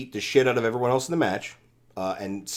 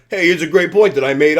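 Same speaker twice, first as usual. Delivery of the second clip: loud, higher Taz impression; 5 semitones higher, 7 dB louder than usual. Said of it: "making my Taz voice".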